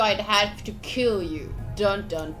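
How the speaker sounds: ordinary delivery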